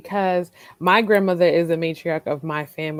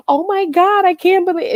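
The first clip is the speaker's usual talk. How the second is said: excited gasp; 11 semitones higher, 5 dB louder than usual. Retold or imitated. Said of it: "She was like".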